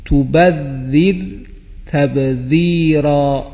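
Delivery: تبذیرا and ذریتی drawn out slowly; slow Quranic recitation. Shown as a demonstration. Teacher models ذال words for pupils, slow recitation. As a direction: chant each word slowly, stressing ذ.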